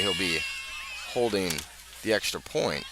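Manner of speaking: ordinary talk, nothing marked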